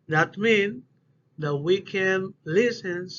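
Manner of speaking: ordinary talk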